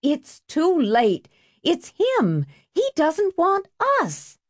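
high, drawn-out protest; anguished refusal. A narrator's reading. Refusing to take him back, high and anguished.

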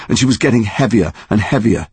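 usual speaking voice

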